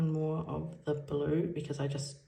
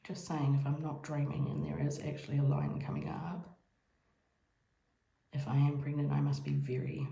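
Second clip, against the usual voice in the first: normal talking voice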